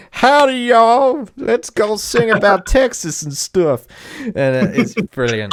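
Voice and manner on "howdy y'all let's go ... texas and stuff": slow, much higher, louder mock Southern accent; hammed-up Southern cowboy greeting; mock Southern drawl. Mocking the band to friends, loud slow mock Southern accent. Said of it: "they're literally like".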